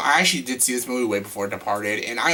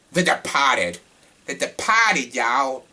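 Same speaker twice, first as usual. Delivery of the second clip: exaggerated Boston accent, dropped R; terrible Boston accent; much slower than usual. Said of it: "my terrible Boston accent".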